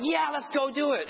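high, bouncy eager cheer; imitating outgoing people with a high cheer; eager go-getter. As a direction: sound like the keenest volunteer. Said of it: "They go and they're like".

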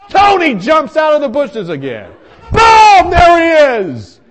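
high, loud comic alarm; excited shout. Retold or imitated. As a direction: blast out the sudden ambush. Startling reappearance in comic story.

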